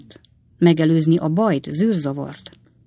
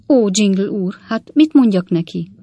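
louder, higher plea of despair; despairing cry; 5 semitones higher, 6 dB louder than usual. A narrator's reading. Spinster aunt begging the suitor for advice, crying out in despair. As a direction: cry out, lost and pleading. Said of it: "kiáltott fel a hajadon nagynéni".

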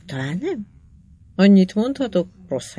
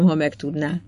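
plain reading voice

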